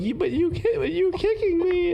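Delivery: very high-pitched comic wail; hammed-up distress. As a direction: play the victim getting worse, voice way up high. Acting victim getting worse on call.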